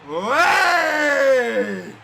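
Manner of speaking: long build into a loud roar; trophy lift roar. Real, not acted. Fans at home lifting the trophy, roaring shout. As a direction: build up then roar hey.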